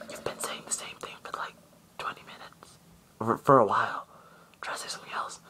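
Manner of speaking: hushed aside to a performer; discreet whisper. Retold or imitated. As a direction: whisper it discreetly, acting out the go-between. Man quietly tipping off Buggy.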